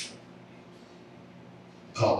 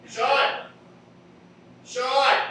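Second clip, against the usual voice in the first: high-pitched call across a theater; 4 dB louder than usual. Acted. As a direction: call to a friend across the room. Man calling friend across theater.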